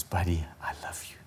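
unvoiced soft whisper. Heavenly Father speaking to his child, whispered. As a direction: breathe it tenderly.

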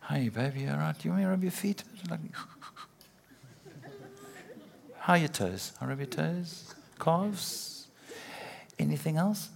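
soft, crooning mock lover offering to rub feet; romantic oaf. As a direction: coo it like a lovesick suitor with a motive. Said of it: "if you're like a romantic oak".

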